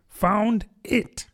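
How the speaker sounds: high-pitched cross mouse; rather cross. A narrator's reading. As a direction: snap back a little, pitched high. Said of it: "replied rather crossly".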